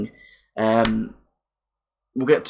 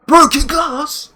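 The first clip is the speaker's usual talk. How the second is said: much higher, slow disbelieving question on "Broken glass?"; incredulous; 12 semitones higher, 4 dB louder, slower than usual. Real, not acted. Reveal then incredulous echo.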